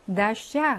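나샤 with the unstressed first vowel said as 아; 나샤 sounded out for the class; pronunciation demo. Shown as a demonstration. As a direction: clearly model 나샤 with a weak first vowel. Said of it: "그래서 얘는 아 발음이죠".

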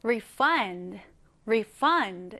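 refund with the stress on the last syllable, re-FUND; stress on second syllable. Shown as a demonstration. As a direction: hit FUND in refund, keep re- light. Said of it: "강세가 뒤에 들어갑니다". (refund, 강세를 뒤 fund에)

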